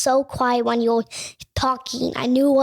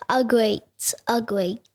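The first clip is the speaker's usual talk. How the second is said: ordinary talk, nothing marked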